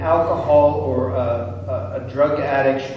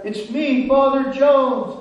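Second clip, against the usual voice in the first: high, raised call down into a pit; hollering back; 10 semitones higher, 5 dB louder than usual. Acted. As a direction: holler your name back down. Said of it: "he hollers back".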